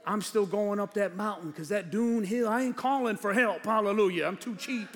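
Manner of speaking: ordinary delivery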